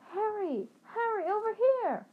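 high, excited call across a street. A narrator's reading. Schoolgirl hails her friend from the bank steps, calling out high. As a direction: hail a friend from afar, high-pitched.

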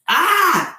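high, loud imitated baby shriek; mock baby yelp, very high; baby squeal. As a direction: squeal like a pre-verbal baby. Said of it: "she would just go like".

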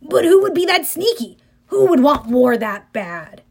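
mystified. A narrator's reading.

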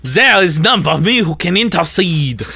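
put-on high, theatrical French accent. Valjean in imagined original cast, high French accent. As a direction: declaim it in an over-the-top French accent. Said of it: "the French accents had me crying".

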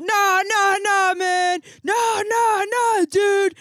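yelling; loud New York argument, no, no; screaming over each other. Retold or imitated. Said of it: "they just scream over each other".

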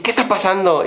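a customer losing patience; irritated caller snapping a question; angry customer. Retold or imitated. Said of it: "se enoja y me dice".

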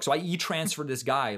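usual speaking voice

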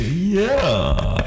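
high, drawn-out mock yeah; teasing high-pitched imitation; mimicking co-host. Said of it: "You said".

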